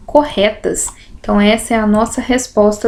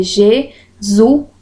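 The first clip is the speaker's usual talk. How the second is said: jesuíta broken into je-su-í-ta; syllabify jesuíta with pauses between the parts; syllable by syllable; much slower than usual. Shown as a demonstration. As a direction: say jesuíta in separate beats, the í standing alone. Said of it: "aqui o certo seria".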